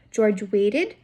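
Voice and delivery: ordinary delivery, nothing marked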